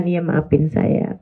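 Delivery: soft, slow, humble sorry to an angry husband; meek apology. Shown as a demonstration. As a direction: apologise gently to calm your husband down. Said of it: "Kalau perlu kamu minta maaf gitu kan".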